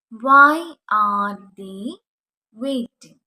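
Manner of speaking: drawn out very slowly, loud start; slow model sentence. Shown as a demonstration. Teacher modelling the question for students, very slow. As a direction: stretch every word for the class. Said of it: "അതെങ്ങനെ നമ്മൾ ഇംഗ്ലീഷിൽ പറയും?".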